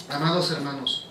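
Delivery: ordinary talk, nothing marked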